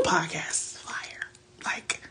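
hushed excited aside; whisper. Real, not acted. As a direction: whisper it like a secret. Excited secret aside to viewers.

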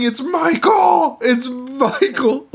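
high, drawn-out hammed-up shriek; stretched mock cry of alarm, laughter in it; mock screaming. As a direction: imitate a frantic scream while cracking up. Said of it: "he starts screaming".

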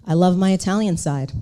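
normal talking voice

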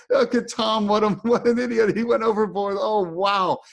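high, teasing friend voice; mock him like a buddy laughing from the raft; mocking buddies. Friends on the raft jeering at Tom, high and mocking. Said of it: "laughing hysterically at me".

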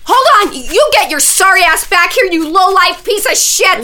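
loud angry shout after someone; furious yelling. Acted. Wife yelling insults after the fleeing man. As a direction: bellow insults at the runaway.

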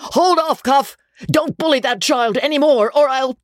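put-on high, strained boyish scream; a schoolboy yelling at a bully to stop, pitched high. A narrator's reading. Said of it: "Dobbin sprang up and screamed out".

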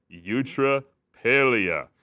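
put-on very slow, loud eutrapelia, drawn out for children to repeat; broad singsong kids theatre. Shown as a demonstration. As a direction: sing out eutrapelia like a kids' show host. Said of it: "can you say that with me, boys and girls?".

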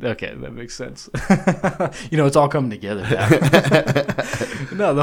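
talking through laughter; laughing. Real, not acted. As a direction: chuckle through the words. Laughing while joking.

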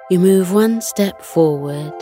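plain reading voice

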